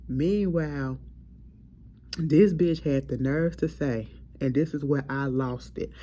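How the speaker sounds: ordinary talk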